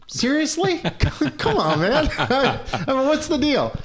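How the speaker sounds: very high, laughing disbelief; incredulous. Retold or imitated. Neighbor refuses the ball, high laughing disbelief. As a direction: pitch way up in amused outrage.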